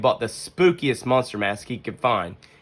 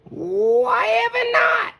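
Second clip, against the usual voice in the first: much higher, gentle mother bear voice; Mama Bear voice; 4 dB louder, much slower than usual. Acted. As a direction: play the wise mother.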